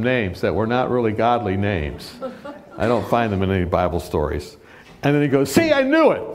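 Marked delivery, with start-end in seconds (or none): 5.5-6.2 gloating. Retold or imitated. Tony gloating at the speaker.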